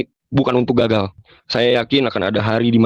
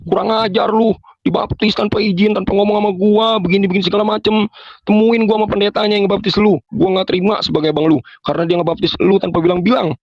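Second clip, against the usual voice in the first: higher-pitched mock scolding rant; older brother furious about the secret baptism, mock scolding; imitating angry brother; 9 semitones higher than usual. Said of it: "abang saya marah-marah".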